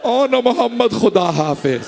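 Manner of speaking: a mother's farewell, eyes closed, voice drifting; grieving farewell. Acted. Mother bidding her son's grave farewell, mild grief. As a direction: say goodbye to the graves, mild ache in the voice. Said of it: "आंखें बंद करके कहा".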